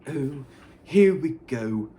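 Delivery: a slow, bitter grumble; bitter Nick. A narrator's reading. Nick as the horsemen arrive, bitter and resigned. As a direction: sour and resigned. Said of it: "said Nearly Headless Nick bitterly".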